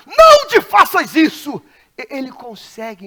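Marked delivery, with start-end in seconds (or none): 0.1-1.6 sudden high shout, full force; loud cry. Retold or imitated. Paul crying out to the jailer, loud shout. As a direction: shout to stop him in time.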